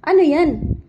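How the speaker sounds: loud, sudden startled question. Real, not acted. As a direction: jump at something the pet does. Startled by pet.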